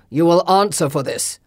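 loud, cold warning; stern lord voice. Acted. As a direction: threaten him coldly. Lord rebukes Falson.

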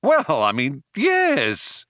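hesitant, leaping high on yes; stall, then agree too eagerly; nervous. A narrator's reading. Ford answering the Vogon, nervous. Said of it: "Ford laughed a nervous laugh".

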